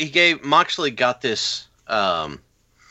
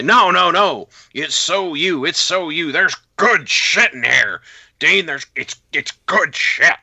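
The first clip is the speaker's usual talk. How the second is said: high, loud, pushy mock praise; mock Vince gushing; 6 semitones higher, 3 dB louder than usual. Moxley mimics Vince insisting the script is good. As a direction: gush over the script like a mocking boss. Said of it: "And he's like".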